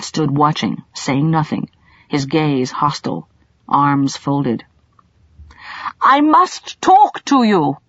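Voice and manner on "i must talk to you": louder, higher, slow call across the clearing; shouting. A narrator's reading. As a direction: call out firmly to a distant man. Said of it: "Colin shouted".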